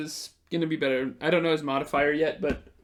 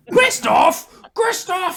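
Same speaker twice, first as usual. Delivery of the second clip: high, loud shrieking call across the inn; wife screaming for her husband; yelling for husband; 9 dB louder, slower than usual. Acted. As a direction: shriek his name, much higher and louder. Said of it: "Grista starts yelling".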